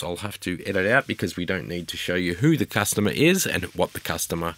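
ordinary talk, nothing marked

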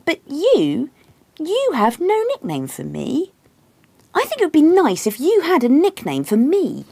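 high, wheedling complaint; pointed, high-pitched bedtime nagging; needling wife. A narrator's reading. Acts herself pleading in bed.

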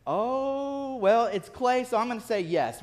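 drawn-out high "oh", then giving in; hesitant agreement. Retold or imitated. Retold reluctant yes to friend.

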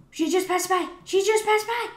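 high, loud excited outburst; excited alarm. Real, not acted. Excited at spotting prey.

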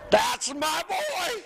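high, drawn-out proud holler; stretched, triumphant exclamation; proud father's shout. Acted. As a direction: crow loudly about your son. Proud exclamation about his son.

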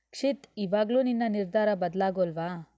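plain reading voice

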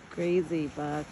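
ordinary delivery

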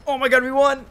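high, loud victory shout with laughter; excited shout. Real, not acted. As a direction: yell with joy at the win. Celebrating match win.

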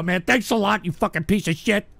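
usual speaking voice

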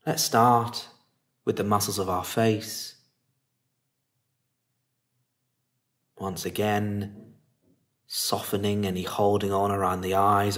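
calm, unhurried meditation lead with long pauses; guided meditation. Acted. Teacher settling listeners into meditation posture and body, calm guiding voice.

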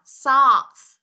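socks with a hissed final S; loud model "socks", S let out clearly. Shown as a demonstration. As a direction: say "socks" and sound the ending S. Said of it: "Chữ S nè, các bạn có thấy chưa".

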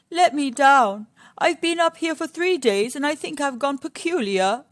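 a mild beg from a trapped lion; begging Lion. Acted.

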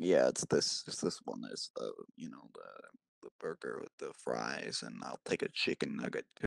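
very low, soft mock voice sinking to a breathy hush; growl-whisper a fast-food order; the other voice. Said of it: "the voice that goes like".

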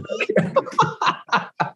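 burst of laughter. Real, not acted. Laughing at friend's joke.

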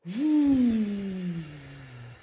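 long loud sighing whoosh out; funny exhale noise. Shown as a demonstration. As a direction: blow it all out with a sigh. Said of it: "Make that noise".

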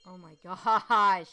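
stunned by a dark twist; shocked. Real, not acted. Player stunned by the family's grim fate.

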